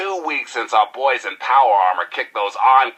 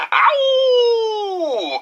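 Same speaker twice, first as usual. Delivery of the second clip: very high howled ow like a dog. Acted. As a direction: howl it out like a hound baying at the moon. DJ howls after his name.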